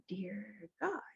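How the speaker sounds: hushed, breathless "Dear God"; whispered prayer. Retold or imitated. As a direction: pray under your breath in fear. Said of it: "I'm sitting in there like".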